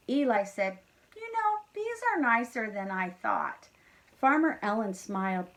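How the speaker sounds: lively storybook read-aloud; reading to children. A narrator's reading. Teacher reading a farm story to kindergarteners, lively. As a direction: read it brightly to a class of kids. Reads picture book aloud.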